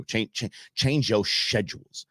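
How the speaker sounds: ordinary delivery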